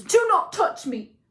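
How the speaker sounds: high, loud, forceful warning; furious shout. A narrator's reading. Grieving son repelling his mother's hands, furious shout. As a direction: shout her off, drawing out each word.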